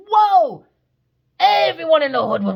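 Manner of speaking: loud high whoa; excited shout. Real, not acted. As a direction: shout it out, thrilled. Exclaims about 80s TV.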